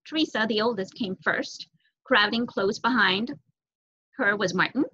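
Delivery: ordinary delivery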